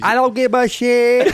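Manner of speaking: high, loud, slow mock yell; defiant shouting mom. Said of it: "Her mom is crazy".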